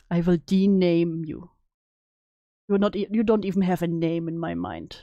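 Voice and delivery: playing a villain pronouncing a curse; mock villain threat. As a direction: pronounce a banishment. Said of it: "you're like".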